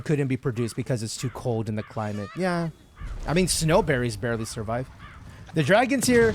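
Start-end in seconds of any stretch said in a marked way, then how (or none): 5.6-6.3 excited. Real, not acted. Dragon appears in game.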